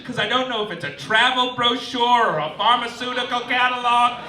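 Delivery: ordinary delivery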